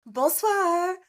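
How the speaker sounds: high, sing-song bonsoir; cheerful bonsoir greeting. Retold or imitated. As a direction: chirp it to everyone you pass. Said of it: "Jared's like".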